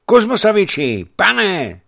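loud, high call to wake the master; waking call. Acted. Messenger shakes sleeping Kuzma awake, loud high call. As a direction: shout him out of sleep.

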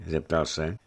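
ordinary delivery

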